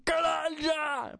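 high, stretched mock shout; high-pitched angry holler, not gruff; angry friend's voice. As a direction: imitate a furious friend yelling, drawing out each word. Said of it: "Só que não é a voz grossa, era tipo".

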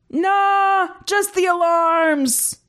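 high, drawn-out creature voice; dismissive alien. A narrator's reading. Crew member dismissing noise to partner, dismissive. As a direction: brush it off in a strained high voice.

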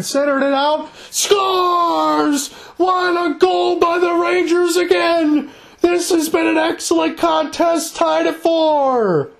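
high, stretched excited goal call; long goal yell. Real, not acted. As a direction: belt out the goal, hold the vowels. Goal call in live play-by-play.

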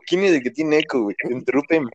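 usual speaking voice

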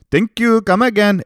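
high, loud mock Indian shopkeeper accent. Comic mocking a cartoon Indian shopkeeper, high loud Indian accent.

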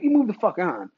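ordinary talk, nothing marked

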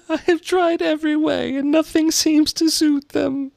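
very high mock-sobbing pigeon; hammed-up despairing complaint; kind of sob. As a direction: lament it in an exaggerated high wail. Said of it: "with a kind of sob".

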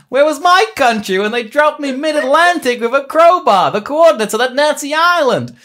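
high, loud, hammed-up indignation; angry rant. Retired pilot ranting that his country abandoned him, angry rant. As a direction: rage in a put-on American pilot voice.

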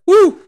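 a sudden, very high and loud whoo; a shrieked whoo of amazement; high whoop. Acted. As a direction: whoop like you can't believe it. Exclaiming at LeBron's anger.

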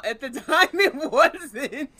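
loud, high, speaking through laughter; laughing. Real, not acted. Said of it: "It's funny now".

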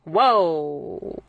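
drawn-out, falling "whoa"; imitating a couple calling a halt; retold startled halt. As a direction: pull up short with a long, trailing whoa. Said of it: "both of us were like".

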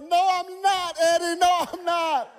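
high, loud weepy denial; tearful protest. Retold or imitated. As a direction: cry back at the singer. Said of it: "It's like".

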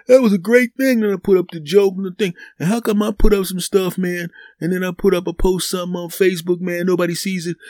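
high, whining rant about no likes; mock a friend crushed by no hearts; whiny complainer. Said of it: "It crushes 'em".

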